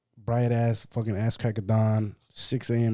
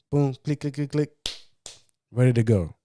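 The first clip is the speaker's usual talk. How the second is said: imitated stamping; 5 semitones higher, 5 dB louder than usual. Mimicking quick stamping.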